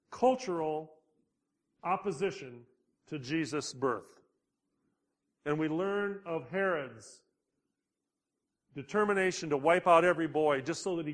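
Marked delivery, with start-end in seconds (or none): none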